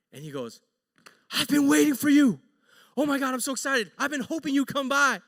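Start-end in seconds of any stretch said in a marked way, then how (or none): 1.3-5.2 eager, high, loud welcome; excited greeting. Retold or imitated. Coworker greeting the wife he has waited for, excited. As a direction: gush with mock-earnest delight.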